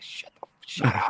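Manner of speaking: low, hushed mock shushing; whispered-down, retold "shut up"; hushed retort. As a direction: imitate telling friends off under your breath. Retort to teasing friends.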